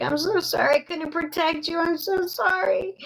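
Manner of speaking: very high, climbing retold tearful apology; imitating crying mother. Mother apologising to the daughter comforting her, imitated crying. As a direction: re-enact her plea, pitch rising into a wail. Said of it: "And she was crying".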